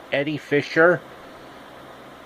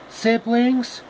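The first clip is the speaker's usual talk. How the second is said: high squeaky question; 9 semitones higher than usual. Acted.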